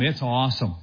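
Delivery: ordinary talk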